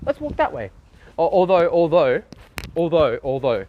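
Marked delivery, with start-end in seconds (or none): 0.0-0.7 high, hushed mock delight at finding a street; squeaky pretend discovery, much higher; mock excited. Lost streamer mimicking his own aimless delight. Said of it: "I'm just like".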